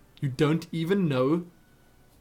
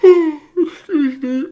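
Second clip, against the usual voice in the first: choking laugh; 12 semitones higher, 12 dB louder, much slower than usual. Real, not acted. Sudden fit mid-question.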